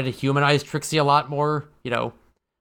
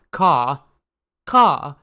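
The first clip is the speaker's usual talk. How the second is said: loud crow caw; 5 dB louder than usual. Pretend. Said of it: "this one just says".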